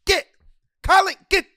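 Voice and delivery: sharp high call to the dog. Real, not acted. Scolding the dog Colleen mid-podcast, loud and high. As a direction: snap the dog's name out loud.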